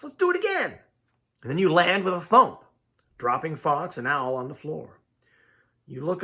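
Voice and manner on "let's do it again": high-pitched, eager animal voice; high gleeful squeal; excited little Fox. A narrator's reading. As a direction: beg for another go in a high Fox voice. Excited Fox speaks in story.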